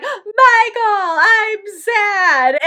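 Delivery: fake-sob his name like a wounded child; mock crying. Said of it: "and she's like".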